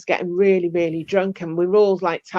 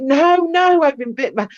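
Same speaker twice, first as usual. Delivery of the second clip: frantic denial; 7 semitones higher, 6 dB louder than usual. Retold or imitated. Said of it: "and I was like".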